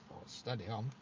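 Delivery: normal talking voice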